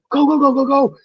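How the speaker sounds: frantic high shouting go go go; screaming. Retold or imitated. Teammate screaming at the pack to chase, high shout. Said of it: "He was just screaming".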